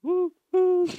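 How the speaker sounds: high loud choo-choo whistle; train whistle. Pretend. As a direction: imitate a train horn.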